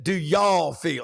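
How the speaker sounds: slow, drawn-out Southern "y'all"; host's Southern version, drawn out. Retold or imitated. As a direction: lean into the Southern "y'all" slowly. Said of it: "And I said".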